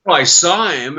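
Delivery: ordinary delivery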